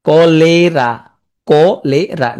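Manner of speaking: কলেরা split as ko-lay-raa; spelling pronunciation. Shown as a demonstration. Teacher fixing a disease spelling, syllable-by-syllable কলেরা. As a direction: stretch each syllable of কলেরা. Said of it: "হয়তো ভুল হবে না".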